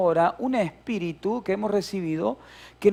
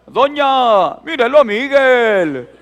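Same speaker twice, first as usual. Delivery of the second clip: high, very loud drawn-out tattling yell; hammed-up childish cry for a grown-up; mock kid's shout; 7 semitones higher, 12 dB louder, slower than usual. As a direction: holler for a friend's mother to complain. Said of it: "él empezó a gritar".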